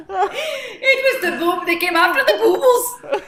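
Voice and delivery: words squeezed out through real laughter, high and slow; giggling so hard it barely gets out; laughing. Real, not acted. Breaking up over the pun.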